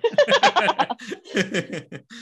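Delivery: big high burst of laughter; loud high laugh. Real, not acted. Laughing at own joke.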